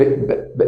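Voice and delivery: ب as a bare burst with no vowel; unvoiced ب demo; voiceless b demo. Shown as a demonstration. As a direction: pop ب with no voice. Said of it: "ما أقولش ب، ب".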